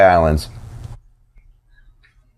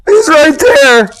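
high, loud, drawn-out wail from the witness stand; hammed-up, high-pitched slow cry; shaken witness; 6 dB louder, much slower than usual. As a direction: play a traumatized attendant pointing out the culprit. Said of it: "some poor shaken fucking attendant".

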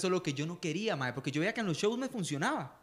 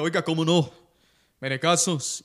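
El Porcio; 2 semitones lower, 5 dB louder than usual. Shown as a demonstration. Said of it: "Mae, voy a imitar a, al Porcio".

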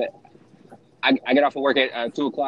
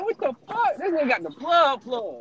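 surprised; 12 semitones higher than usual. Retold or imitated. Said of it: "I said".

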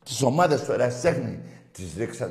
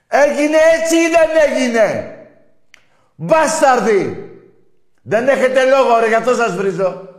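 much higher and louder enraged yelling; furious shout; 10 semitones higher, 10 dB louder than usual. Real, not acted. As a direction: scream the accusation at them. Shouting accusations at rival fans.

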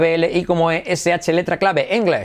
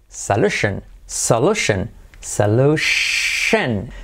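very slow solution; 5 semitones lower, slower than usual. Shown as a demonstration. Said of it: "solución".

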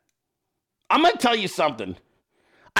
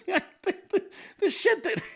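laughing; 11 semitones higher, 6 dB quieter than usual. Real, not acted. Laughing at Randy's post.

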